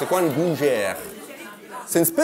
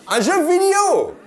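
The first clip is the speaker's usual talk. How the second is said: mock surprise; 12 semitones higher, 4 dB louder than usual. Real, not acted. Mock outraged repeat.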